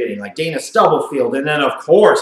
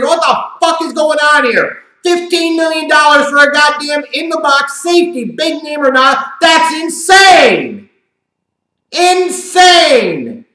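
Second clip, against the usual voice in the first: higher, with a slow shouted insane; outraged rant; 10 semitones higher, 4 dB louder than usual. Acted. Commentator ranting about the contract, outraged and loud. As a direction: rant in disbelief.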